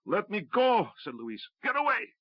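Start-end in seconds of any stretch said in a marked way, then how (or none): 0.0-0.9 desperate plea. A narrator's reading. Luis begging to be dropped. 1.6-2.1 desperate shout. A narrator's reading. Luis urging Rue off.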